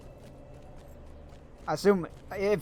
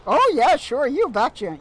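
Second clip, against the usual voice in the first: much higher and louder, slow hammed-up Minnesota accent; 8 semitones higher, 7 dB louder than usual. Streamer doing the sheriff for chat, hammed-up Minnesota accent. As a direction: stretch it out like a cheery Minnesotan cop. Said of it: "I can't do accents or voices".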